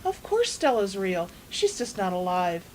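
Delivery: usual speaking voice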